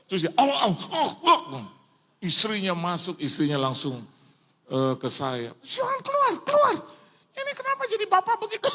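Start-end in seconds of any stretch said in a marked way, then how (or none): 0.4-1.6 loud, high "ow ow" cries; hammed-up high yelps; imitated howling. Imitates the sick man's sounds. 5.6-8.7 loud, very high panicked wife; hammed-up high-pitched outcry; acted alarmed shouting. Acts the wife's alarmed outburst.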